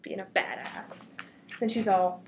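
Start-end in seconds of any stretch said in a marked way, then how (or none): 0.0-1.1 unvoiced whispered aside. Real, not acted. Narrator whispering a comment, hushed aside. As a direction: murmur it under your breath.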